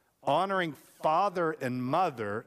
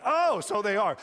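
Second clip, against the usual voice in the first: higher-pitched retold "oh" of realization; mock surprised dad; 6 semitones higher, 4 dB louder than usual. Son retells dad reacting to backwards batteries, mock surprised. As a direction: play dad suddenly noticing his mistake.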